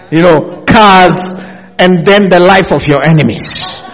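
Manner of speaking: put-on God listing what young men ask for, loud preacher shout; booming divine voice. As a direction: proclaim it like God teasing Solomon. God speaking to Solomon, loud.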